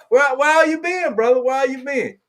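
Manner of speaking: high-pitched, colloquial run-together asking; high, casual question. Real, not acted. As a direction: call it out high and loose. Asked again when not understood.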